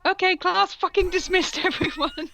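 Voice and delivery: high, bright-pitched teacher shout-out; perky teacher voice. Acted. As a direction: dismiss the class with gusto. Voicing female teacher.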